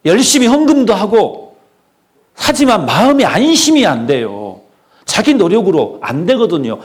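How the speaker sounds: loud, high-pitched sermon delivery; forceful preaching. Real, not acted. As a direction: preach it hard, stretching the key words. Preacher drives the point home.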